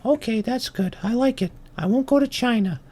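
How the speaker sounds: high-pitched tiny ant voice; little ant. Acted. Ant accepting the bull's advice, high little voice. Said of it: "And he says".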